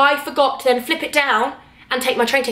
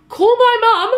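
high, louder pleading cry; desperate plea; 10 semitones higher, 4 dB louder, much slower than usual. Retold or imitated. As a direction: beg them to call your mum. Pleading with ticket inspector.

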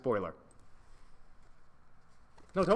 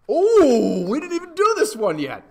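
excited realization; 9 semitones higher, 10 dB louder than usual. Real, not acted. Excited realization mid vlog.

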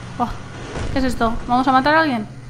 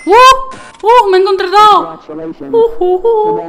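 loud higher excited cry; excited whoops; 6 semitones higher, 4 dB louder than usual. Real, not acted. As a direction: whoop with glee. Excited hoots. (gritos de emoción)

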